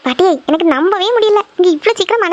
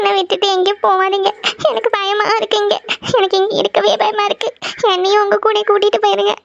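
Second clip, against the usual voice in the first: high, tearful pleading with sobbing gasps; sobbing in fear; 4 semitones higher than usual. Acted. Frightened wife begging her returned husband to stay, sobbing. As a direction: plead through frightened sobs. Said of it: "என்னாச்சு ஜனனிக்கு இப்படி அழுதுட்டு இருக்கா".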